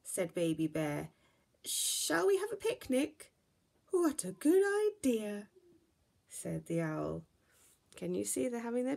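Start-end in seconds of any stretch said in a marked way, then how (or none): 1.6-3.3 much higher, louder disappointed bear cub; little bear voice. Acted. Baby Bear suggests picnic. 3.9-5.5 much higher, louder hooting owl. Acted. Owl agrees.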